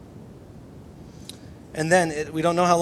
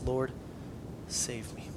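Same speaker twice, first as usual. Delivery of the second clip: hushed, breathy-less whispered cry for help; whispered plea; 3 semitones lower, 10 dB quieter, slower than usual. Retold or imitated. Peter sinking, crying out to Jesus.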